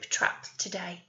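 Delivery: ordinary delivery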